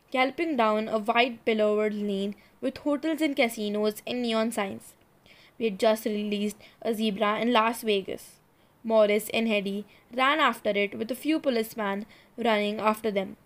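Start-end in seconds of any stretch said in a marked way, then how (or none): none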